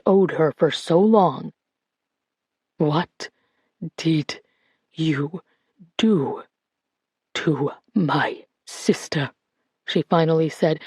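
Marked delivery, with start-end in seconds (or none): 2.8-9.3 slow, soft, one word at a time; each word drawn out. Acted. Colette demanding the truth from her sister's kidnapper, slow drawn-out words. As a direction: press every word out slowly to make him answer. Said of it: "drawing each word out".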